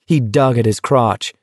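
ordinary delivery, nothing marked

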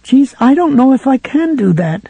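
a scared clerk balking; nervous protest. A narrator's reading. Sue to Ralph about locking the door, nervous.

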